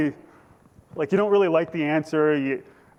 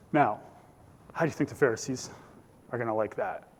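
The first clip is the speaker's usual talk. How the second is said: usual speaking voice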